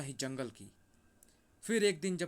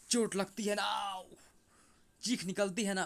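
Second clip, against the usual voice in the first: angry taunting; 4 semitones higher than usual. Acted. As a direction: rub the pain in while striking. Wolf-god punishes hunters with stick. (गुस्से भरा ताना)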